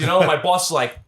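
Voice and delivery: usual speaking voice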